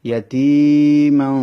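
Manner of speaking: ইয়াতিমান chanted slowly and loud, nasal ending held; slow tajweed chant; Quran recitation. Shown as a demonstration. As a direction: stretch the tanwin of ইয়াতিমান in the nose. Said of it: "এই তানবিনটাকে গুণনা করতে হবে".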